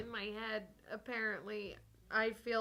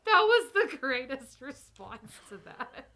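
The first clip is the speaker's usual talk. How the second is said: laughing; 2 semitones higher, 9 dB louder, slower than usual. Real, not acted. Still laughing at the candy corn.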